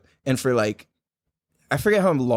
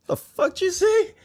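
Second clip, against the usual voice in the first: high, stunned "the fuck you say"; like someone just insulted you; incredulous. Retold or imitated. Calvin snapping back at his mentor, high and incredulous. Retold reaction to mentor's jab.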